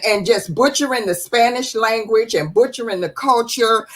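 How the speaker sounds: ordinary talk, nothing marked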